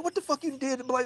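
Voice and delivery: hammed-up high alarm; mock accusation. Friend accuses him.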